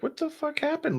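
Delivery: high, hushed mock confusion; confused outburst. Losing the photo, high hushed mock confusion. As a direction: pitch up in baffled disbelief. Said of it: "and then I was like".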